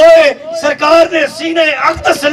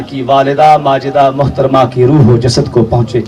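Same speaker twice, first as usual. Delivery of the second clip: much lower, steady supplication; closing prayer; 10 semitones lower than usual. Acted. Preacher closing the sermon with a low blessing prayer. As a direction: pray over the gathering, calm and low.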